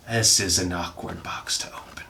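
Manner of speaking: whispered under the breath. Shown as a demonstration. Opening a box, whispers to the camera. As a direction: hush it right into the mic. Whispered repeat of same line.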